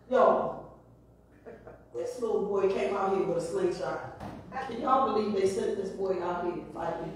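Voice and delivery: low, soft swaggering taunt; mock Goliath taunt. Preacher voices Goliath taunting the boy with a slingshot, low and soft. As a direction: brush the boy off as a joke. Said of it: "people would be like".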